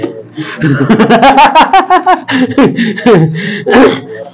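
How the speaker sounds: long peal of laughter, tailing off; amused laugh. Real, not acted. Pastor joking that he is updated too, hearty laugh. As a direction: laugh heartily and let it run down.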